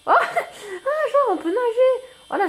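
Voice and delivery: a squeal of delighted surprise, laughing. Real, not acted. Découvre qu'on peut nager. (surprise ravie, beaucoup plus aiguë et riante)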